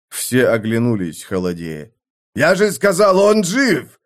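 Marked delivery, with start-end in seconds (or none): 2.3-4.0 high, insistent shout; angry yell. A narrator's reading. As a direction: yell it to prove your point. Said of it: "заорал однорукий Шаван".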